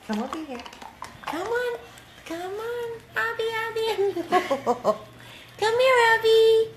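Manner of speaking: high playful calling to a pet; talking to a dog. Real, not acted. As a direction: coax the dog over, high and bright.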